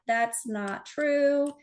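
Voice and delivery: mock correcting. Marking a blunder in red, slow mock correction. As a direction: draw out each word of the correction.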